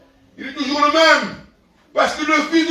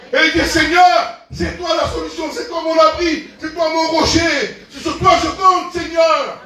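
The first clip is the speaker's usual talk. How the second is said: raised, fervent prayer voice; 5 semitones higher than usual. Acted. Preacher leading the prayer, fervent and raised. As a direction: cry out to the Lord.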